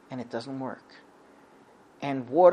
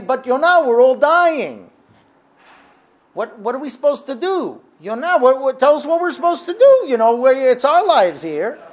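high, loud, stammering plea; acted desperate pleading; 5 semitones higher, 5 dB louder than usual. Sailors begging Yonah for their lives, desperate. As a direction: beg for your life, voice pushed up. Sailors beg as they are dying.

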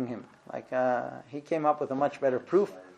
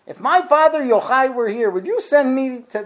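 raised high and loud, pleading in disbelief; indignant protest; 9 semitones higher, 10 dB louder than usual. Acted. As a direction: protest loudly as if insulted. Rabbi protests being sent to danger.

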